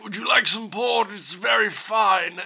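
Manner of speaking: genteel British gentleman, slowing on the last phrase; genteel old British man. Acted. Old expert offering a guest some port, genteel British voice. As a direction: imitate a courteous old Englishman. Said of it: "like, very genteel old British man".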